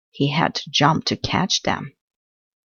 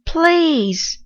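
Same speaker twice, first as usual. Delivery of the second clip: high, louder pleading shout; shouted plea; 14 semitones higher, 4 dB louder than usual. A narrator's reading. Tired mouse shouting at crickets to let him sleep, high shout. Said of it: "shouted the mouse".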